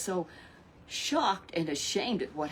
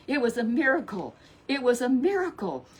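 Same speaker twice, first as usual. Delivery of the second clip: excited exclaim; 5 semitones higher, 5 dB louder than usual. A narrator's reading. Exclaiming the miracle.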